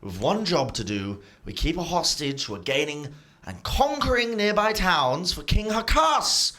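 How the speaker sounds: a hint of a patient schemer, rising high; scolding head. GM voices the smart head lecturing its twin. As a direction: coax the other head along.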